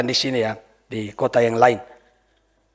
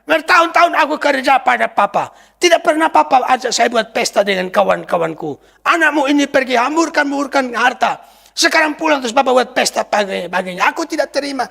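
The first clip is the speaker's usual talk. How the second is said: higher, raised complaint of a resentful son; mock angry son; 7 semitones higher than usual. Preacher voicing the angry elder son to his father. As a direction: protest like the jealous elder brother.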